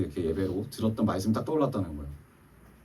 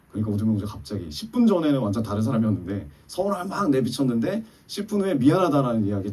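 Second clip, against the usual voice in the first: usual speaking voice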